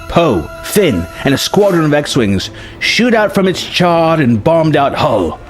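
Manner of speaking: ordinary delivery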